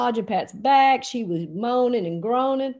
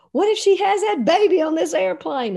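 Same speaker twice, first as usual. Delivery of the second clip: high, loud alarmed question; 7 semitones higher, 5 dB louder than usual. Retold or imitated. Pilot to coach about baby aboard, alarmed. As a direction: burst out with it, voice pitched up.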